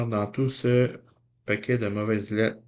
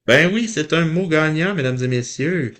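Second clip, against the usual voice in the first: higher and louder, like a game-show win; bright game-show winner call; excited announcing; 5 semitones higher, 7 dB louder than usual. Real, not acted. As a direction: announce the winning word with delight. Celebrates a winning word.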